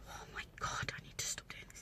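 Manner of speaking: hushed whisper to herself; whispered thought. Retold or imitated. Lying bedbound, thinking to herself, in a whisper. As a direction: whisper it like a private despair.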